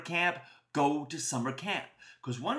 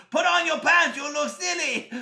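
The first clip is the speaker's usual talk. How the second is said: high, loud comic holler; comic calling out; 10 semitones higher, 7 dB louder than usual. Acted. Runaway pants yell at boy down the street, comic holler. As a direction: holler it after someone running away.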